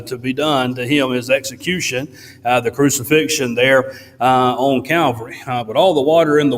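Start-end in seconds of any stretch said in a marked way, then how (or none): none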